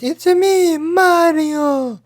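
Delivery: very high cartoon plumber squeal; Mario impression. Podcaster showing how the game character goes, high Mario impression. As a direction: squeak it out and hold the last word. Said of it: "from Super Mario, where he goes".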